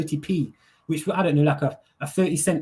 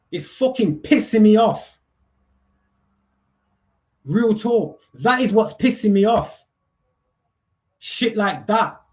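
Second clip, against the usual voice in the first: real anger; 3 semitones higher, 3 dB louder, slower than usual. Real, not acted. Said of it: "why am I getting emotional".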